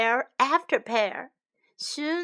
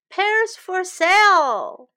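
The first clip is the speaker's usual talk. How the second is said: market call; 7 semitones higher, 8 dB louder, slower than usual. Acted. Seller calling out at market.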